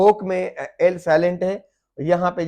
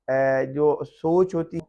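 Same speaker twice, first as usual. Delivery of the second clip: usual speaking voice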